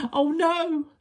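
high, loud little-wolf shriek; frightened shriek. A narrator's reading. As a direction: shriek in alarm as a small scared animal. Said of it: "shrieked the little wolf".

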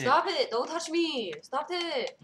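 loud, high mock protest; playful shout. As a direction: yell at the player to back off. Playful protest at other player.

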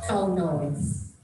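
normal talking voice

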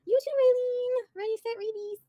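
high, slow sing-song farewell; drawn-out cheery bye to Raylene; sing-song sendoff. Real, not acted. Sing-song goodbye to viewer.

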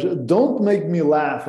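slow and clear, every word separate; careful teacher model; slow model phrase. Shown as a demonstration. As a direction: lay it out slowly for the class. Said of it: "é uma frase que gosto muito".

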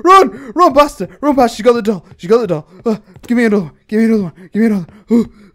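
loud, urgent, breathless bursts; panicked shouting. Real, not acted. Player fleeing as she got the doll, frantic shouting. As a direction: yell frantically while fleeing. Flees after doll is taken.

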